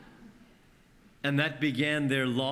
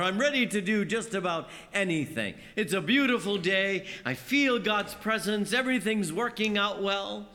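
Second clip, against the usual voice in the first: high, bright-eyed eager plea; eager faith; 6 semitones higher than usual. Acted. A believer on a good day, eagerly sure of faith.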